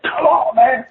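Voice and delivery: high, strained retold call; urgent calling. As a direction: imitate urging a friend to run. Retold calling to friend while fleeing.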